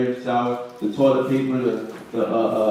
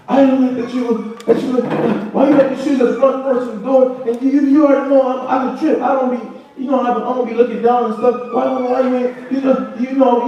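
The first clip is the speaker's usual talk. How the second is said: high-pitched, rambling nagging voice; mock venting; 10 semitones higher than usual. Preacher mimicking someone venting about shoes on the porch, high mock nagging. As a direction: imitate a complainer venting, pitched way up. Mocking a spouse venting in argument.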